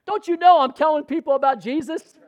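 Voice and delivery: high, loud mock protest; indignant outburst. Preacher acting out his reply to tax people, indignant outburst. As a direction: cry out in exasperated disbelief.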